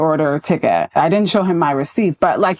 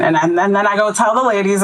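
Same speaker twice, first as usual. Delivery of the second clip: ordinary talk, nothing marked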